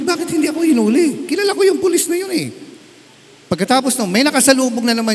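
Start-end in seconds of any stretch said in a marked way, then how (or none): 0.0-2.5 high, quick amazed voice, much higher than his preaching; imitating a baffled, high-pitched question; puzzled disbelief. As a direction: voice the convert baffled he wasn't arrested. Retells the man's puzzled question.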